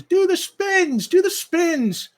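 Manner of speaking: very high, loud, slow chant; excited little girl. Acted. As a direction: beg him gleefully, clapping. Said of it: "she says".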